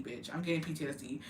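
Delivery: ordinary talk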